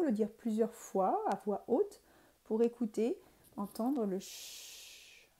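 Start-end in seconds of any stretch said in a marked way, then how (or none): 3.6-5.3 slow, drawn-out "ch" in "entendre le ch"; stretched ch. Shown as a demonstration. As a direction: lean on the "ch". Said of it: "bien insister sur le mot".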